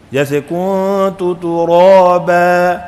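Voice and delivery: کنت ترابا very slowly, the ending bent toward e; wrong recitation. Shown as a demonstration. Teacher demonstrating how Quran readers mispronounce ترابا. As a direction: draw out ترابا with a slanted final vowel. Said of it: "غلط پڑھ دیا".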